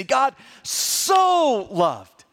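very slow, "so" stretched long; intense stress on SO. Shown as a demonstration. Pastor explains the Greek word order, long emphatic "so". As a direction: draw "so" right out for intensity. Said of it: "bringing that sort of emphasis and intensity".

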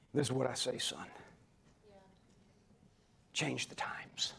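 soft, slow whisper; whispered divine voice. Acted. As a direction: whisper it like God speaking at night. God whispering to Samuel.

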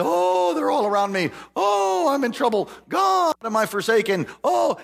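high, wailing cries of Oh; mock lament. David crying out to God in trouble, high hammed-up wailing. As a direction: ham up David's distress, voice cracking high. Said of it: "David is talking about".

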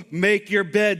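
normal talking voice

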